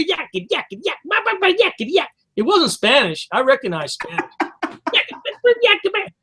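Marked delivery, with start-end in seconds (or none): 0.0-2.1 high-pitched gibberish mimicry; mock foreign chatter. Man imitating shoppers' foreign talk at Walmart, mock chatter. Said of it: "what the fuck language they were speaking". 4.4-6.1 high-pitched gibberish mimicry; mock foreign chatter. Man imitating shoppers' foreign talk at Walmart, mock chatter. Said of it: "what the fuck language they were speaking".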